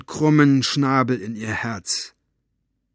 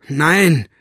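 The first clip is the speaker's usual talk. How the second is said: loud, raised refusal; snapped; 5 semitones higher, 8 dB louder than usual. A narrator's reading. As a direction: snap a firm no at the man. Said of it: "fuhr Mo ihn an". (laut angefahren)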